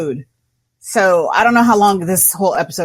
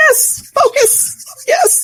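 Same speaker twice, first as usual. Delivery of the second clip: high, shrill victory yelps; excited cheer; much slower than usual. Real, not acted. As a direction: cheer the roll. Celebrating a good roll.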